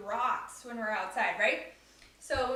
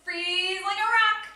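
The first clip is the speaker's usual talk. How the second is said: freeze call, loud and higher; loud demo command; 6 semitones higher, 9 dB louder than usual. Shown as a demonstration. Yoga teacher showing kids the freeze call, loud and bright. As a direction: call it out like a game.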